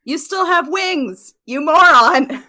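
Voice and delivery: slow, building to a loud insult; exasperated scolding. Acted. Companion telling Baku to use the wings, exasperated shout.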